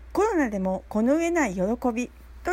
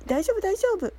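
higher-pitched, light quoted reassurance; 9 semitones higher than usual. Retold or imitated. Mistaken person forgiving the speaker, in a high quoted reassurance. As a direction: voice someone waving off a mistake, pitched well up.

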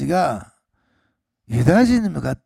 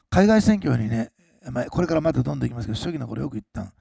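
ordinary talk, nothing marked

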